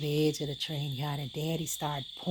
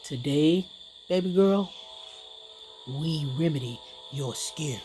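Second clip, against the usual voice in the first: lightly voiced father, lower and deliberate; daddy voice; 4 semitones lower than usual. Daddy waking his little girl early, fatherly. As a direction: announce it as daddy, with a pause after each phrase. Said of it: "he woke me up and said".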